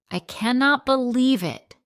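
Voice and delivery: loud, high disbelieving shout. A narrator's reading. Wife shouting at husband over his note. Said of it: "I shout".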